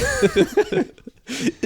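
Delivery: high-pitched fit of laughter; laughing. Real, not acted. Co-host cracking up at his friend, high laughter. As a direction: lose it laughing.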